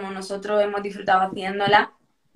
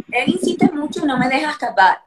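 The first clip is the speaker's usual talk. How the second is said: laughing through the words, a bit louder; 4 semitones higher, 4 dB louder, faster than usual. Real, not acted. Actress laughing about her persistent love interest, amused. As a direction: giggle it out, amused and a little embarrassed.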